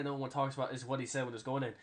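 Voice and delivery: normal talking voice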